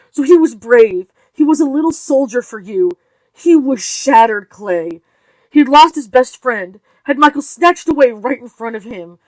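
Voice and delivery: mother raging at her husband, loud; angry. Acted. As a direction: hammer each word at him. Wife's furious tirade at husband.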